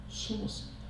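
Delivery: 숨었습니다 whispered with no voice. A narrator's reading. Preacher telling how Saul hid, in a whisper. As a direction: hush it like Saul is hiding.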